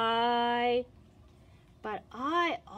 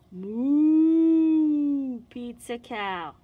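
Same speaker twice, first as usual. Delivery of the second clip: gasp, then a long, much louder moo; 3 semitones higher, 10 dB louder, much slower than usual. Acted. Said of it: "Then what will you say?".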